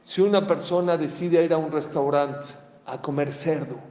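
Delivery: ordinary talk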